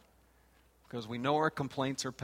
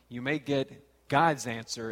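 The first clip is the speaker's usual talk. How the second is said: usual speaking voice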